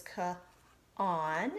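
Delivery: "ka, on" said as two separate sounded pieces; syllable sounded out. Shown as a demonstration. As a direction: sound out "ka" then "on" slowly. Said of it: "the first syllable says".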